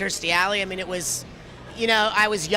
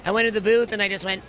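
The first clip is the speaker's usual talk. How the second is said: ordinary delivery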